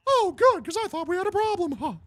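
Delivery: high, quick, cheery-menacing mouse; Mickey Mouse. Acted. Mickey satisfied the band obeys, high mouse voice. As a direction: chirp the veiled threat in falsetto. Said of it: "Legit South Park Mickey Mouse".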